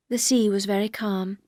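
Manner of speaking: plain reading voice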